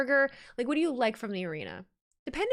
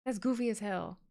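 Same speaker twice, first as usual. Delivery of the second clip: softer, dry throwaway; deadpan repeat; 5 dB quieter than usual. Real, not acted. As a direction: say it again flat under your breath. Repeats the reaction.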